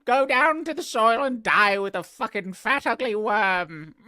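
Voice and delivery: high, loud, squeaky creature rant; a sneering butterfly screeching at a worm; shrill butterfly voice. Acted. Butterfly screeching insults down at a fat caterpillar.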